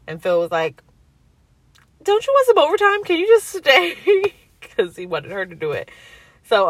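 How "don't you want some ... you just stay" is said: husband begging the doctor to stay late; coaxing husband. Retold or imitated. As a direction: sweet-talk the doctor into staying.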